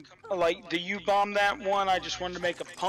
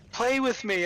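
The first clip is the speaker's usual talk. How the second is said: put-on child voice; 7 semitones higher, 5 dB louder than usual. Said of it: "she'll just come up to me and be like".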